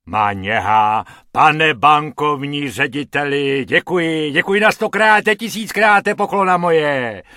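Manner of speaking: loud, gushing thanks, rising high; overjoyed, thank him a thousand times; merry calling out. Acted. Musician thanks the bank director, loud and merry. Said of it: "volá harmonikář ... rozjařeně".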